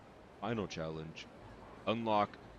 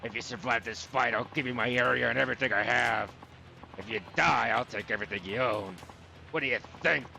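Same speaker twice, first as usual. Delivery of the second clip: raised, theatrical dub voice, building on key lines; game character with swagger; 3 semitones higher than usual. Acted. Gambler proposes a final bet to his rival, emphatic and theatrical. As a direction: play the desperate gambler raising stakes.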